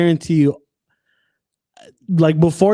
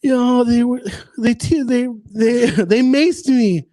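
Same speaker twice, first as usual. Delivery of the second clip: higher-pitched, halting mock-tearful voice; mock crying lady; 7 semitones higher than usual. Crying lady telling an interviewer what happened, mock tearful. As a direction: imitate a tearful complaint, stumbling and half laughing. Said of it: "she's crying".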